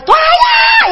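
put-on very high squeaky kid's yell; child yelling. Kid in the bathroom yelling to the aunt, squeaky child's yell.

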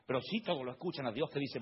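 ordinary talk, nothing marked

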